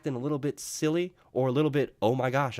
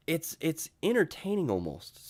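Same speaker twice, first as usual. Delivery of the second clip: ordinary talk, nothing marked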